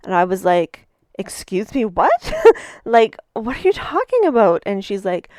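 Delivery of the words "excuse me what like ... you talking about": higher, louder incredulous "what?"; stunned disbelief. Retold or imitated. Speaker answering the stranger's accusation, high and incredulous. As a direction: react as if accused out of nowhere.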